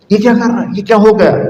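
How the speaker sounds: high, amazed questions; startled mother. Retold or imitated. Mother asks what has happened to her baby, high and amazed. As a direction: cry out in wonder like the mother. Mother keeps crying out in wonder.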